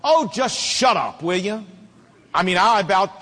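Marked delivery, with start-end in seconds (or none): 0.0-1.6 mild mock yell of a fed-up kid; exasperated retold yell. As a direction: imitate a boy yelling back at his mom, not at full volume. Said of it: "turned to his mom in the stands and yelled".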